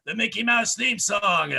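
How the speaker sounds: put-on rally the kids like a party clown; cheery singalong host; kids' party host. Kids' entertainer announcing a singalong.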